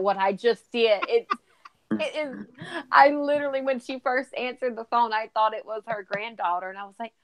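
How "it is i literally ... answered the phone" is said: ordinary talk, nothing marked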